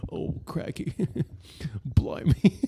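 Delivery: hammed-up British accent with a laugh. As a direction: put on a comic British accent, amused. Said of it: "That's my British accent".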